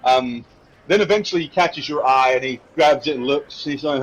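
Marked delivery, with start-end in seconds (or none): none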